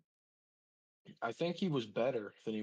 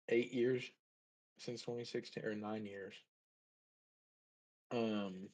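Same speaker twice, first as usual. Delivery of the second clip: ordinary delivery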